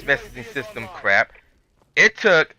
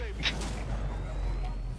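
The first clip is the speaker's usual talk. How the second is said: exasperated laugh; 15 dB quieter than usual. Real, not acted. Laughs at absurd situation.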